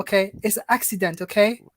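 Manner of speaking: light attempt at a Somali accent. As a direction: play it as a Somali uncle with a Somali accent. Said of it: "Is that the Somalian".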